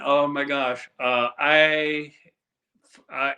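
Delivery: normal talking voice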